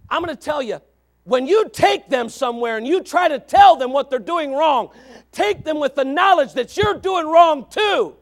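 loud, high, hammering delivery; full-force preaching. Real, not acted. Preacher urging humble correction of others, at full force. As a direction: thunder it out, stretching the last phrase.

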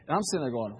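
ordinary delivery

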